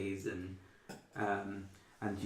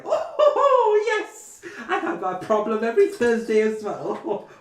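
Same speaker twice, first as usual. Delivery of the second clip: very high, loud, giggly posh lady; posh laughing reply; 13 semitones higher, 15 dB louder, slower than usual. Retold or imitated. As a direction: trill it through a laugh, all airs. Second rich lady laughing along.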